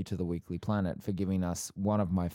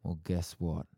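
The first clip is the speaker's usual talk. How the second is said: ordinary talk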